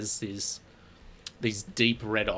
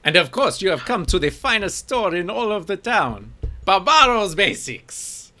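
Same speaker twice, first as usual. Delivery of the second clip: much higher, louder showman merchant; grand shopkeeper; 10 semitones higher, 8 dB louder than usual. Acted. Shopkeeper boasting to the strangers about his store, in a grand, loud showman voice.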